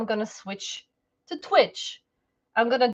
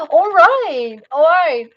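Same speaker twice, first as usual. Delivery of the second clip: loud all right; 3 semitones higher, 9 dB louder, slower than usual. Real, not acted.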